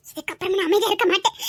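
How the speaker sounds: low-pitched, contained resolve; low, determined vow. Acted. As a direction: make the vow low and held in. Vows to act, first low. (தாழ்ந்த, உறுதியான குரல்)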